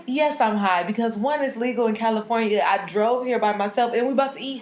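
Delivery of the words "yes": ordinary talk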